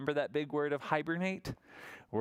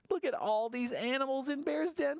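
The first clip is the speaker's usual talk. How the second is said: usual speaking voice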